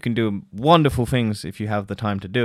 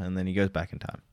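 normal talking voice

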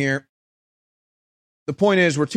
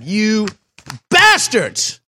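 outraged cry; 4 semitones higher, much slower than usual. Real, not acted. Outraged condemnation.

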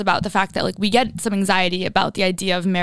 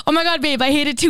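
mock panic, pitched up; retold freak-out; 5 semitones higher than usual. Friend retells boyfriend hiding his ring blunder, mock panic. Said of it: "Freaking out".

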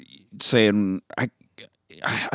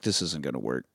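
much lower and softer, under the breath; muttered aside; 6 semitones lower, 9 dB quieter than usual. Retold or imitated. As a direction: hide it from the phone, mutter low. Covering phone, aside to robbers.

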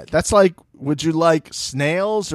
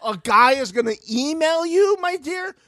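high, patronizing "my dear"; condescending mock; 11 semitones higher than usual. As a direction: talk down to her sweetly. Mocking her suggestion.

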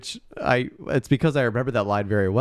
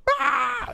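startled yelp. Retold or imitated. Actors scream at Scorpion King.

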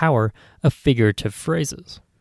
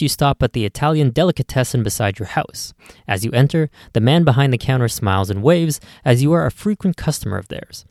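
plain reading voice

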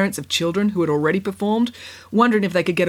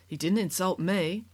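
quiet; 8 dB quieter than usual. A narrator's reading. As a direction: say it meekly. Dirk mild reply.

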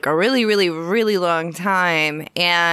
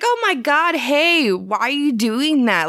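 mock over-familiar greeting, pitched up at the start; imitating excited stranger; 6 semitones higher than usual. Stranger greets her by mistake, imitated excited greeting. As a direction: play the pushy stranger, high on "Oh my God".

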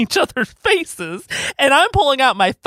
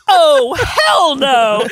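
slow, loud oh hell no; furious retort; 5 dB louder, much slower than usual. Retold or imitated. Wife storming off at the hotel, loud and slow. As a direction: drag out the outrage loudly.

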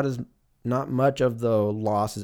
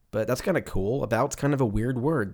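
normal talking voice

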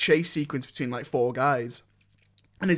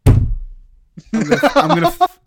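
mock outrage; 10 semitones higher, 9 dB louder, slower than usual. Mock outrage at acting insult.

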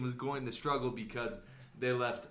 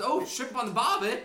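hammed-up Aussie voice, pitched higher; mock Australian accent; 7 semitones higher, 4 dB louder than usual. Co-host riffing back in mock Australian accent. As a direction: echo the cliché like a jolly Aussie. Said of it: "I can't do those accents".

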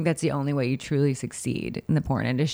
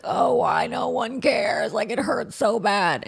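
mock self-pity; 5 semitones higher, slower than usual. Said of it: "just be like".

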